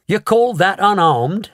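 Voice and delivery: high, slow sharp retort; snapped. A narrator's reading. Mother snaps at Wonka, sharp. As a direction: snap back at Wonka. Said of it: "snapped Missus T V".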